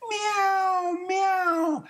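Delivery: high, thin mewing like a sad kitten; high kitten meows. Acted. Kittens mewing sadly to their mother, very high. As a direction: meow like a tiny crying kitten.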